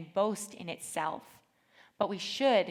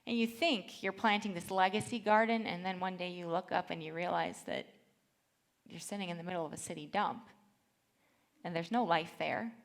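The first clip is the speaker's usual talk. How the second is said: normal talking voice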